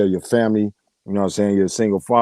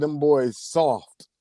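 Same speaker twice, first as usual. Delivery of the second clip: "soft" stretched out long, pitched up; stress on SOFT; 4 semitones higher, much slower than usual. Retold or imitated. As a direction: draw out "soft" slowly for emphasis. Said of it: "Emphasis on soft".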